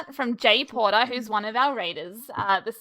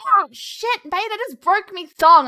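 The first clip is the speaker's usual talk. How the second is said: put-on loud, high broad Aussie accent; 9 semitones higher, 7 dB louder than usual. Traveller imitating an Aussie yelling at the airport in broad Aussie. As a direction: bellow it like a broad Aussie bloke at LAX. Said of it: "I just hear this Aussie go".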